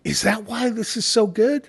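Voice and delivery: high, wondering guest voice; delighted question. Retold or imitated. Guests asking the bartender, in a high amazed voice. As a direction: imitate a guest amazed by the drink.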